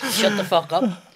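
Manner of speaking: high, loud retort; shouted comeback. Retold or imitated. Comic telling heckling crowd off, shouted retort. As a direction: fire it back at the hecklers.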